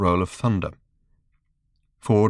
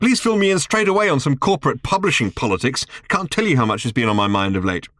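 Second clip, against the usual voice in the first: sarcastic; 6 semitones higher than usual. Acted. Arthur sarcastic at Ford.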